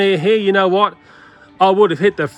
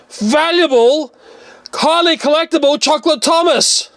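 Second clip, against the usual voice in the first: pitched-up theatrical dismay at the smashed toy; mock shock; 9 semitones higher, 3 dB louder than usual. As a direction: drag out the disbelief like a stunned collector. Owner discovers smashed toy.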